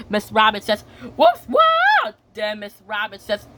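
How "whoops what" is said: high, loud acted yelp; startled slipping cry. Child replays Miss Rabbit slipping on a car, startled yelp. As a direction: let out a high mock yelp.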